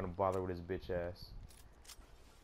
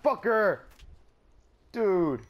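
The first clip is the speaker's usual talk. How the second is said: loud, very high angry yell; furious shout; 11 semitones higher, 13 dB louder, much slower than usual. Real, not acted. Gamer screaming at the player who killed him, furious shout. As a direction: explode at the enemy.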